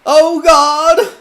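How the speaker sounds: high, loud anguished cry. Acted. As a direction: cry out to God in shock. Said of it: "cried the Reverend".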